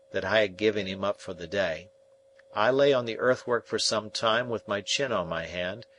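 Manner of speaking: ordinary talk, nothing marked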